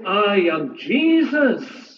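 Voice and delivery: slow, weighty divine declaration; slow solemn proclamation; voice of Jesus. Acted. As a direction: announce it slowly with solemn majesty. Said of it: "he will say".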